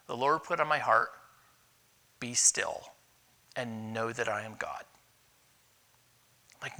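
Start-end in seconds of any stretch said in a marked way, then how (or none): 2.2-4.8 low and soft, a hushed divine reassurance; hushed sacred voice. Retold or imitated. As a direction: speak it as God calming the heart. Quoting God's word to him.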